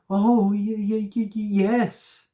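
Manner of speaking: high, stammering in fear; terrified stammer. A narrator's reading. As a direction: stutter yes, terrified. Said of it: "cried Molly".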